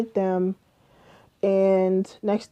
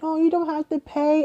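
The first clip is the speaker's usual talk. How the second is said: mocking the friends; 8 semitones higher than usual. Mimicking the friends' reply.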